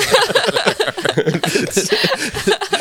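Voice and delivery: high burst of laughter; big laugh. Real, not acted. Cackling at the idea of burning haunted furniture. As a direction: laugh out loud, high and long.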